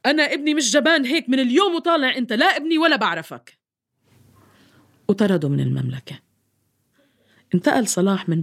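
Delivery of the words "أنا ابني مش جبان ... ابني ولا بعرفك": high angry royal shout; furious king shouting. Acted. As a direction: shout down at the coward son. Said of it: "لحد ما صاح الملك". (ملك غاضب يصرخ)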